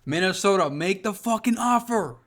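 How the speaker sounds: loud call-out to Minnesota. Real, not acted. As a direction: shout the plea at the team. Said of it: "bro, I'ma say it".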